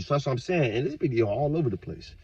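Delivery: ordinary delivery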